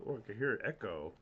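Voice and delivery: ordinary talk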